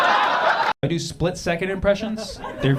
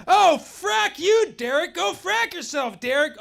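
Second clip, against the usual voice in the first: much higher, slow outraged telling-off; high hammed-up angry woman; mock furious woman; 13 semitones higher, 3 dB louder, slower than usual. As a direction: spit the curse at Derek. Angry girl in app.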